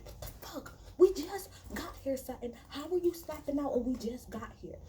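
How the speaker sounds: high-pitched disbelief; incredulous. Retold or imitated. Recapper replays reaction to castmate's early outburst, incredulous.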